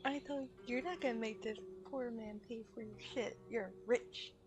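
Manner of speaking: normal talking voice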